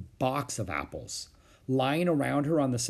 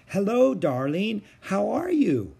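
higher, slow cheery aunt; aunt's greeting; 9 semitones higher, 4 dB louder, slower than usual. A narrator's reading. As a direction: greet her niece brightly. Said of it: "asked Aunt Beatrice".

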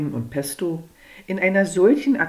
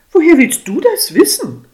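high, loud offended retort; a piqued wife, much higher and louder; slightly piqued; 10 semitones higher, 10 dB louder than usual. Acted. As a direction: snap back, stung. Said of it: "gibt sie leicht pikiert zurück".

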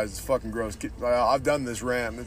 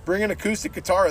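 mocking Christians; 7 semitones higher than usual. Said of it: "these Christians will go and like".

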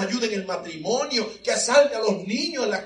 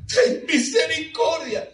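Ten misericordia cried high and slow; a desperate mother begging aloud; slow, high, loud plea; crying out; 10 semitones higher, 4 dB louder, slower than usual. Retold or imitated. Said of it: "comienza a gritar".

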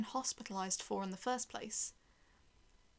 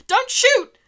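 very high, very loud desperate yell; 19 dB louder than usual. A narrator's reading. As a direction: scream it at armed soldiers to stop them firing. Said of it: "I yelled".

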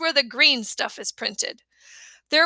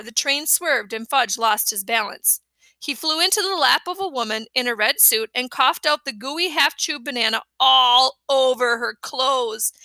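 ordinary delivery, nothing marked

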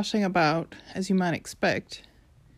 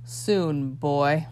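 snarled; 4 semitones lower than usual. Acted. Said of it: "The fiery man snarled".

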